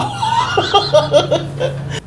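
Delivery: long high, airy laugh; high-pitched burst of laughing; real laughter. Real, not acted. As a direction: crack up at your own joke. Laughs at own fish comparison.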